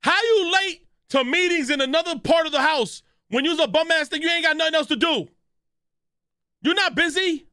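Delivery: loud, high, yelling the roast at him; hyped roast yell. Real, not acted. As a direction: clown him at full volume, way up high. Friend yelling a roast at someone.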